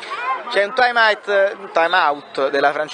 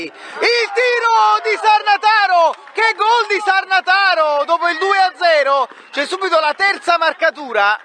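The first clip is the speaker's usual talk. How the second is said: excited goal shout; 12 semitones higher than usual. Real, not acted. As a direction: roar out the goal. Third goal, shouted commentary.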